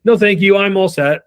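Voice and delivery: loud, brisk brush-off; salesperson pushback. Retold or imitated. Homeowner brushing off the salesman, loud and firm. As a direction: shut him down firmly. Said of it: "give him my salesperson pushback".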